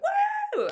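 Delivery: high, loud joyful whoop with a laugh; excited whoop. Retold or imitated. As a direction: cheer a runner on with a squeal. Cheering at runners.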